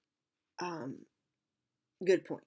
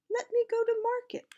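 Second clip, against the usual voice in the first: little pot pleading; 6 dB louder than usual. Acted.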